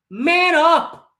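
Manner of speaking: high, loud command; furious shout. Real, not acted. Fan yelling at the crying player, high and loud. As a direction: yell it like a drill order.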